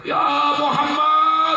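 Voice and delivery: high, drawn-out bedouin's call; imitated shout. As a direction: call out slowly as the rude villager bursting in. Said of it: "Langsung tiba-tiba teriak".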